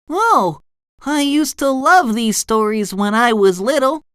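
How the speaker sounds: high, delighted cartoon voice; SpongeBob. A narrator's reading. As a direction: pitch it up with fond delight. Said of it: "read the title".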